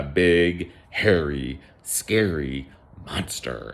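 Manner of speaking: slow, drawn-out spooky narration; storybook monster build-up, slowly; ominous storytelling. A narrator's reading. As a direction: stretch each word to build mock menace.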